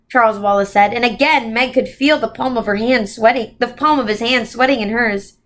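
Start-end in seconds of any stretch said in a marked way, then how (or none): none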